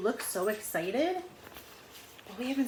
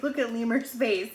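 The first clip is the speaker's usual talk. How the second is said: usual speaking voice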